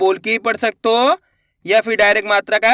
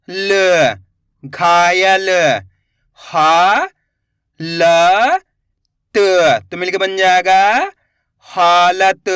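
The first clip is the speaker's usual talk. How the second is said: slow syllable drill; 3 semitones lower, much slower than usual. Shown as a demonstration. Teacher sounding out letters for children, slow and clear.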